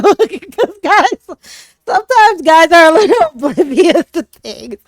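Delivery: talking through giggles, high and loud; laughing. Real, not acted. Laughing at the oblivious guy.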